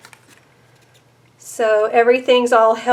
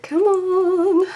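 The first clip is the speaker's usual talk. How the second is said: normal talking voice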